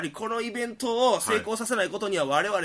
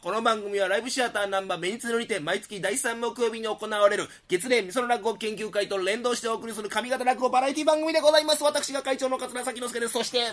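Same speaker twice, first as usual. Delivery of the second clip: 一息の口上; 5 semitones higher than usual. Acted. 一息で番組口上.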